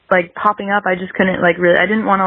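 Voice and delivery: normal talking voice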